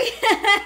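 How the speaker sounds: a loud, high embarrassed burst of laughter. Real, not acted. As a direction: laugh it off after the slip. Laughs after botching her line.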